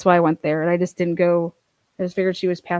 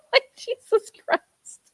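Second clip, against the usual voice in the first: high, slow, squealing laugh-talk; slower than usual. Real, not acted. Host cracking up over the barking dog, laughing.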